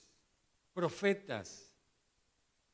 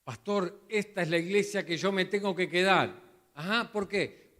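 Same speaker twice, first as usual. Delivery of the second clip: eager newcomer; 5 semitones higher, 9 dB louder than usual. Retold or imitated. Acted church newcomer and pastor.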